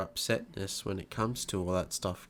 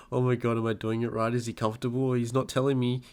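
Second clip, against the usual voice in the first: fast, raised fretting; anxious new parent; 3 semitones higher, 5 dB louder, faster than usual. Retold or imitated. New parent fretting over the baby, rising to a loud plea. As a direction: fret out loud, voice climbing, ending in a loud plea.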